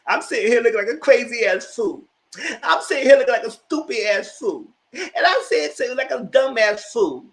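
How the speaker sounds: high, loud mocking rant; mock upset friend. Friend stood up, mocked ranting high and loud. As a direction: ham up the stood-up friend's complaint. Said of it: "he didn't show up".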